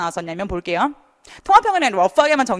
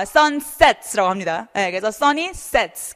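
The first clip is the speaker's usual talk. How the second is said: 1 slow model word; much slower than usual. Shown as a demonstration. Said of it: "fall이 아니라".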